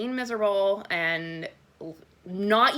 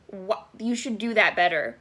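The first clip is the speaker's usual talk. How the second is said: ordinary talk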